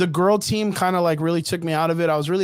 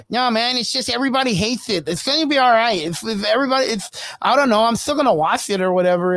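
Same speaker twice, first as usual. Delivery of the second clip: higher, pinched nerdy guy defending the film; nasally nerdy voice; 5 semitones higher than usual. Retold or imitated. Mimicking the nerdy stock worker's nasal whine. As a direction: do the nasal little nerd, pitched up. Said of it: "in his nasally little nerdy voice".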